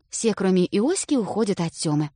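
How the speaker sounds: plain reading voice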